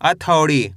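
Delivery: अथौड़ी read as one joined word, falling at the end; model pronunciation. Shown as a demonstration. Teacher answers how to read it, अथौड़ी as one falling word. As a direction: join the syllables of अथौड़ी, letting it drop. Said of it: "कैसे पढ़ेंगे?".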